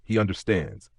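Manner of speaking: plain reading voice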